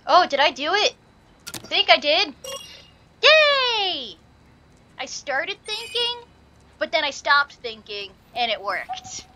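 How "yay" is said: high loud yay; cheer. Real, not acted. Gamer cheers after solving the puzzle. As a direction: cheer the win. Celebrating success.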